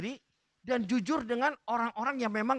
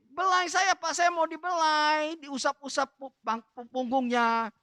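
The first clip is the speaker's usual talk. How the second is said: high, drawn-out wheedling plea; coaxing wife; 6 semitones higher than usual. Retold or imitated. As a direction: imitate a wife begging to be stroked. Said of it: "dia suruh apa?".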